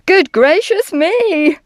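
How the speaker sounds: high, loud shocked exclamation; swooping high cry of shock; exclaiming. A narrator's reading. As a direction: exclaim in startled alarm.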